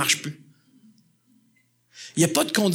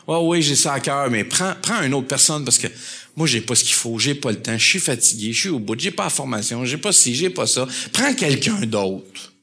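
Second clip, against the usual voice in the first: weary excuses; 8 semitones lower, 3 dB quieter than usual. Acted. Excuses fatiguées du croyant.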